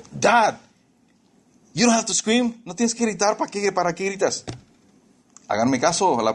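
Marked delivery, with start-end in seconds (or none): 0.1-4.5 mock kid voice, pitched higher; kids complaining. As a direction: whine like a child telling dad off. Kids complain about dad yelling. (hijos quejándose con voz más aguda)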